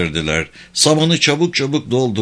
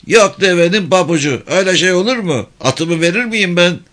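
high, loud rustic protest; pitched-up indignant soldier; indignant villager; 8 semitones higher, 4 dB louder than usual. Acted. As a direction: refuse hotly in a high country-man voice. Haceli indignant about giving his horse.

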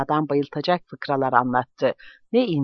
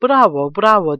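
louder, higher cheer; shouted bravo; 4 semitones higher, 7 dB louder than usual. A narrator's reading. Guest cheering Kilio's plan to move, shouting. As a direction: shout your approval. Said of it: "diye bağırdı".

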